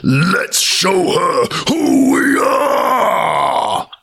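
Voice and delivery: slow, booming windy spirit voices; angry wind spirits howling at a trespasser; screaming whirlwinds. Acted. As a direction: roar it out like furious wind. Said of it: "they screamed".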